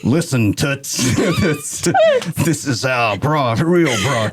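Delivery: slow, smug patronizing radio man; condescending old broadcaster. Pretend. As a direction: drawl it out like a cigar-chomping DJ. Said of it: "Let me mansplain broadcasting to the broadcaster".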